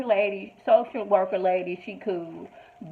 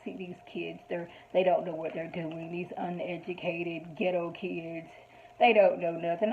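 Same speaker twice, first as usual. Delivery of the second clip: snobby manager; 3 semitones lower, 5 dB quieter than usual. Retold or imitated. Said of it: "he's like".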